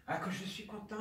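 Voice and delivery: exclamation nettement plus aiguë; joie soudaine. Acted. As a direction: laisse éclater la joie d'un coup. Mourant se réjouit, chuchote puis s'exclame. (élan de joie soudain)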